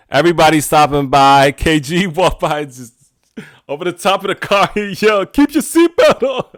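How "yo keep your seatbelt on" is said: high-pitched holler through laughter; amused impression of shouting from a car; imitated call-out. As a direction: call out teasingly to someone passing, high and amused.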